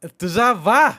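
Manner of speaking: high, loud cheeky call; insolent retort. A narrator's reading. Shepherd answers Rama from up the tree, loud and insolent. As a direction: throw it down rudely from a height. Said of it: "बेमुरवतीने उंचीवरून बोलला".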